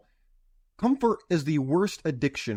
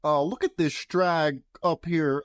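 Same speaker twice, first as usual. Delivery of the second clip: ordinary talk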